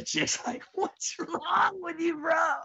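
high voice through laughter; laughing. Real, not acted. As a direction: squeal it out laughing. Laughing while voicing the girl.

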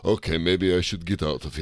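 put-on sheepish rejected vampire with a Transylvanian accent; Dracula accent. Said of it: "What's with your stupid accent?".

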